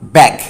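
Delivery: ব্যাগ said as a clear model word; teacher models ব্যাগ; ব্যাগ with an open a vowel. Shown as a demonstration. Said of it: "প্রথমে teacher বলছে".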